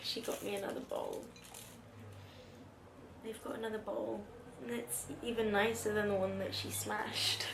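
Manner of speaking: quiet, choked-up voice; soft teary voice. Real, not acted. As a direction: speak softly, near tears. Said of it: "everything's making me cry".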